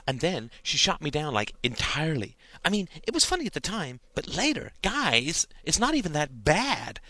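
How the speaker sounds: high-pitched mock teen-girl chatter; imitating gossipy ex-friends. A narrator's reading. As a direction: mimic schoolfriends gossiping, pitch jumping up. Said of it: "They imitated the conversations of their ex-friends".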